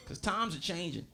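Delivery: ordinary talk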